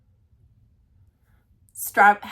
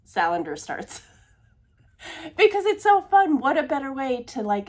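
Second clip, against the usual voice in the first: ordinary talk, nothing marked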